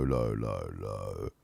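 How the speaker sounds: going deep down on "low, low". Shown as a demonstration. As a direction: drop deep and soft on "low, low". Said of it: "back to low".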